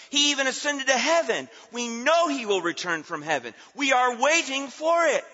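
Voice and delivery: high, ringing pulpit proclamation; triumphant sermon climax; forceful preaching. Real, not acted. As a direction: drive each phrase home with conviction.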